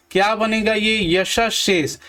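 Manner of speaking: ordinary talk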